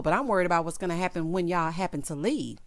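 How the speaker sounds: ordinary delivery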